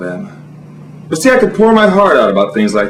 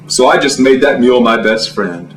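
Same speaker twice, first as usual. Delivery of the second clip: usual speaking voice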